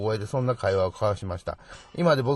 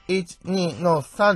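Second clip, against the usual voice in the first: countdown; 8 semitones higher, 5 dB louder, much slower than usual. Acted. Counting down before the stabbing.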